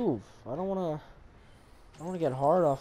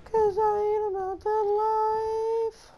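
squeaky falsetto, slow; high squeaky voice; 5 dB louder, slower than usual. Pretend. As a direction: sing it high and drawn out. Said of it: "You guys like my voice?".

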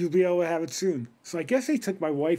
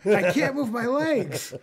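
laughing mock panic; 7 semitones higher, 4 dB louder than usual. Panicking after epidural, retold.

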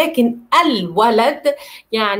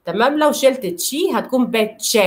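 ordinary delivery

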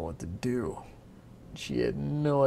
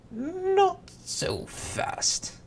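very high, very slow drawn-out warning; sly king; 3 dB louder, much slower than usual. Acted. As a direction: stretch it out slyly. King stops her.

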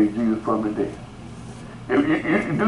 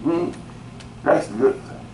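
ordinary talk, nothing marked

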